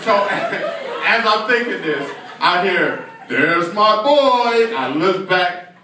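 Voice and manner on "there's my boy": high, long-drawn happy call; proud arriving father. Acted. Father arriving to pick up his son, drawn-out happy call. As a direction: stretch it out in a fond greeting. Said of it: "This little boy's father walked in the door".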